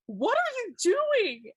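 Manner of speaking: high, loud incredulous question; exasperated mom. Retold or imitated. Mother finding her daughter taking a selfie, high and incredulous. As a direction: scold the found child in disbelief.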